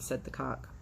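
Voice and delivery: plain reading voice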